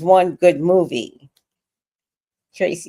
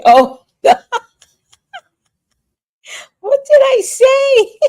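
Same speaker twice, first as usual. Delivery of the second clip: very high, loud squeal through laughter; cracking up; 6 dB louder, slower than usual. Real, not acted. Asking laughing viewers about the holiday, cracking up. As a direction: squeal the question while cracking up. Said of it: "laughing at me pronouncing the holiday".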